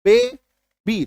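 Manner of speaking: "Baby" as two detached syllables; broken into syllables. Shown as a demonstration. Teacher showing a double syllable, "baby" said haltingly in two beats. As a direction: stop briefly between the halves of "baby". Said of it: "दो बार में बोला ना रुक रुक के".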